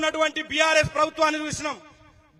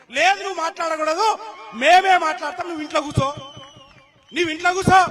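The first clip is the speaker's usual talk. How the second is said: imitating a domineering command, raised voice; mock silencing order; 3 semitones higher, 7 dB louder than usual. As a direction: order her to stay home, acted out. Voices men silencing the daughter. (గద్దించి ఆజ్ఞాపిస్తున్నట్టు అనుకరణ)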